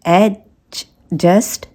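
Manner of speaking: "Adjust" stretched slowly, the d and j both heard; very slow "adjust", d then j; slow spelled-out demo. Shown as a demonstration. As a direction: drag "adjust" out so each sound is heard. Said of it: "दो sound आते हैं".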